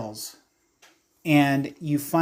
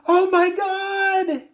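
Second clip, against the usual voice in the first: high, drawn-out cry of alarm; horrified cry; 4 dB louder, slower than usual. Acted. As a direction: cry out slowly at a horrifying sight. Character sees glowing sludge overhead.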